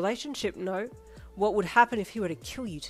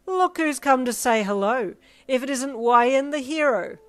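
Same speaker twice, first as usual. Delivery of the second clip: loud, slow, mocking taunt; cruel mockery; 6 semitones higher, 14 dB louder, slower than usual. A narrator's reading. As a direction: drag out the insult for the crowd. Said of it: "The way he said it made the rest of the gang laugh cruelly".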